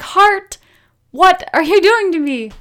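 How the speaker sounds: exasperated wail. Retold or imitated. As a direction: cry out at your heart in comic despair. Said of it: "I was like".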